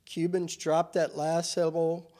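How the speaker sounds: ordinary delivery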